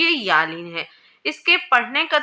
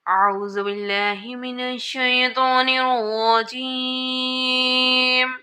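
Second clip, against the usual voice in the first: اعوذ باللہ recited slowly in a chanting tune; slow recitation; 3 semitones higher, much slower than usual. Real, not acted. Teacher opening the drill with slow chanted recitation. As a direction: intone it slowly like Quran recitation. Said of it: "opens the lesson recitation".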